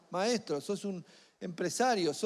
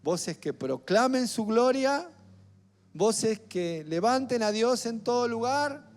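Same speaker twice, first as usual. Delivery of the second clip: rousing preaching; 3 semitones higher, 3 dB louder than usual. Real, not acted. Preacher calling the church to be God's voices, rousing and emphatic. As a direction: stretch each call and build in force.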